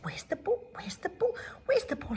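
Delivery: a little dog hunting for her ball; dog searching. Acted.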